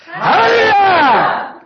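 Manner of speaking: huge high shout, stretched slow; shouted hallelujah. Acted. Preacher leading the congregation in a practice hallelujah, full shout. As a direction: shout it to knock the wall down. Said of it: "let's shout the hallelujahs".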